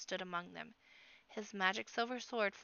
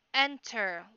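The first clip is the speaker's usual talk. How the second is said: louder, projected one-word command; called out; 3 semitones higher, 7 dB louder than usual. A narrator's reading. Chief summoning the stranger from the darkness, calling out. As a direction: call it firmly down a tunnel. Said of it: "Lopkin called out".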